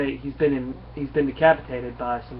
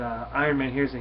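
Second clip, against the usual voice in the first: ordinary talk, nothing marked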